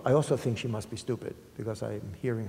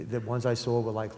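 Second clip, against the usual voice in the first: normal talking voice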